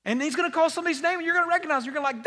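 loud, high, fast preaching; full-force excited sermon; fervent preaching. Acted. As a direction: preach it out with rising joy.

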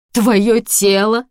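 loud, slow, high sneer; disgusted. Acted. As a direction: spit it out with disgust. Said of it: "с отвращением процедил".

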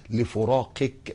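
لفراقك drawn out slowly; stress on لفراقك. Shown as a demonstration. Preacher stressing لفراقك to the congregation. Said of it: "مش لموتك".

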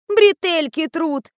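high, slow fussy whine; капризно. A narrator's reading. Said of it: "не успокаивалась Катя". (капризное нытьё высоким голосом)

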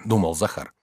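plain reading voice

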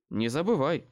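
ordinary delivery, nothing marked

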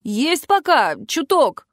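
high, strained call-back; shouted reply. A narrator's reading. Friend shouts back while fighting. (ответный выкрик в бою)

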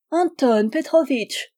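high, slow, coaxing call; cajoling old maid, vinegar dipped in cream; wheedling. A narrator's reading. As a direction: coax him with sour-sweet tenderness. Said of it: "fit-elle d'un accent câlin".

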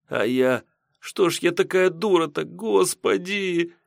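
high-pitched anguished lament; tearful self-reproach. Acted. Lekarka alone regrets driving him away, tearful lament. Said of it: "По щекам её вновь потекли слёзы".

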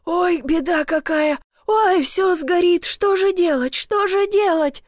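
high, drawn-out wailing; lamenting. A narrator's reading. Panicked neighbour watching the fire, lamenting loudly. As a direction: wail helplessly, stretching the words. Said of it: "продолжал причитать".

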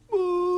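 high droning buzz of a warplane; imitated plane roar. Boy mimicking a warplane roaring overhead, high buzz. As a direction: drone it like a fighter plane overhead.

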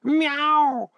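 very high, startled cat cry; drawn high meow; yowled meow. A narrator's reading. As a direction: yowl like an alarmed cat. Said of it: "завопил я".

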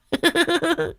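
high leering giggle. A narrator's reading. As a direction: giggle at his own idea. Cười sau câu nói. (cười khúc khích giọng cao)

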